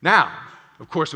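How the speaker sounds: ordinary talk, nothing marked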